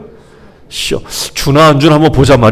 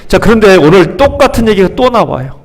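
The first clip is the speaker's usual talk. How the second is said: ordinary talk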